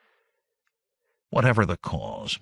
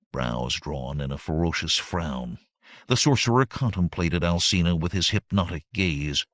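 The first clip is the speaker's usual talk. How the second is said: ordinary delivery, nothing marked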